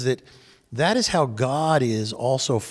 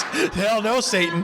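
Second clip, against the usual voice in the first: a laughing hell no; laughing retort; 6 semitones higher, slower than usual. Retold or imitated. Wife's retort to the coach, said through laughter. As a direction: toss it off while laughing.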